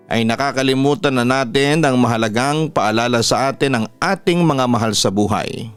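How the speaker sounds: plain reading voice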